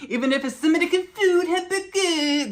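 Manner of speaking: put-on high, loud French-accented food order; mock French accent. Reprises the joke French voice.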